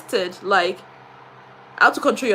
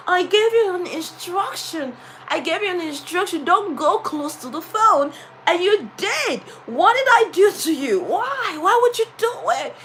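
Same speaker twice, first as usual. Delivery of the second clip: angry scolding; 7 semitones higher than usual. Shown as a demonstration. Teacher scolding the boy about the costly repair, angrily. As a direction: berate the pupil over the broken phone. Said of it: "Look at someone that is angry".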